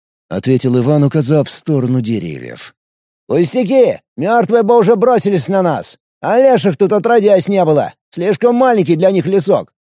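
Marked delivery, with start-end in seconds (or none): 3.3-9.7 overly relaxed. Acted. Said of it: "Излишне расслабленный тон".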